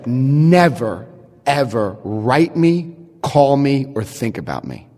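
low, deliberate dismissal, falling away at the end; cold finality. Retold or imitated. Woman dismissing her ex at her door, cold finality. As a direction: space it out and sink to a low close.

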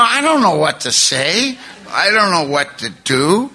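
slow, drawn-out mock wail; hammed-up helpless lament. Retells his helplessness after the confession.

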